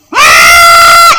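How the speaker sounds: loud, strained operatic ah like a showy tenor; mock opera wail. Preacher imitates a church singer showing off, loud mock opera wail. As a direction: belt out a hammed-up operatic ah. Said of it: "cuando aparece el [name]".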